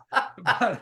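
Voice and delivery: a real laugh breaking out, loud and high; loud burst of laughter. Real, not acted. Guest laughing at his own joke, loud laugh.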